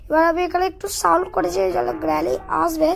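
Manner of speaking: ordinary talk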